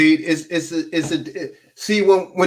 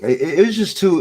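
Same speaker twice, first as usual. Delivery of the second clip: ordinary delivery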